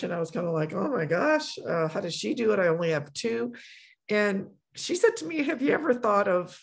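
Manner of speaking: ordinary talk, nothing marked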